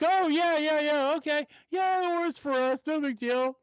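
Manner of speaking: high, agreeable mock voice; easygoing driver friend. Driver friend answering drive-thru worker, high agreeable imitation. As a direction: mimic a friend breezily going along with it. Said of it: "And Patrick's just like".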